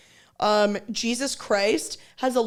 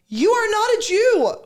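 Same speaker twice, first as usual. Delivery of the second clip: high, loud emphatic declaration; louder and higher, hammering it home; all caps; 9 semitones higher, 5 dB louder than usual. Acted. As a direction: read it out like an all-caps rebuke. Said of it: "All caps".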